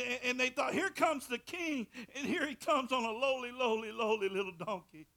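loud, high, rising preacher's cry; fiery preaching. Acted. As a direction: preach it hard at the congregation. Preaching at full force.

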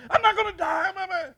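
high, loud mock holler; retold screaming. Upset bullfighter answering the preacher, imitated high screaming. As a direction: imitate the mad man screaming, pitch way up. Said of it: "he started screaming and hollering".

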